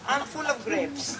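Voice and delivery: ordinary delivery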